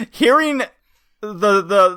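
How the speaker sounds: usual speaking voice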